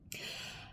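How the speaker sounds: soft unvoiced sigh; a quiet exhale to steady yourself; shaky sigh. Real, not acted. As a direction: breathe out the emotion. After near crying.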